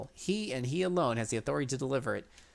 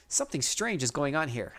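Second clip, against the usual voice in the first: ordinary delivery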